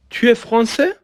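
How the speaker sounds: "tu es français" as a question, pitched high and climbing to the end; rising question intonation. Shown as a demonstration. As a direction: ask "tu es français" by tone alone, voice lifted high. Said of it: "با تغییر تون صدامون".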